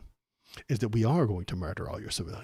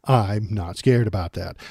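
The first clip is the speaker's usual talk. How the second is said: ordinary delivery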